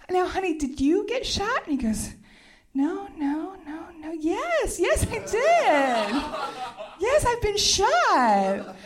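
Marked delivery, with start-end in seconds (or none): 0.1-1.6 high-pitched, fully voiced query; retold insistent question. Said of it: "it just went just like this". 2.7-8.7 high whimper building to drawn-out wail; mock wailing realisation. Retold realisation of being shot.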